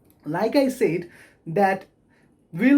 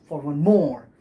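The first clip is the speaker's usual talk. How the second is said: "for one" light, "more" loud and long; unstressed, unstressed, stressed; 5 semitones lower, 3 dB louder than usual. Shown as a demonstration. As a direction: skim "for one" and land on "more". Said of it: "So the unstressed, unstressed, stress".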